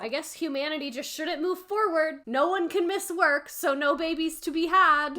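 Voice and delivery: sarcastic outrage. Real, not acted.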